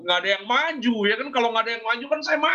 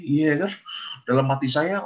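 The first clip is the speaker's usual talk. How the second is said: ordinary delivery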